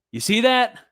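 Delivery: got-you, look at this; eager challenge to viewers; triumphant excitement. Real, not acted. Pointing out key word.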